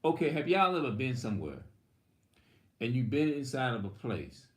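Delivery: ordinary talk, nothing marked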